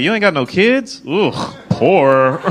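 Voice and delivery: high, loud question, then drawn-out disgust; mock disdain. Comic snubbing women without children, mock disdain. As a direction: ask incredulously, then judge in disgust.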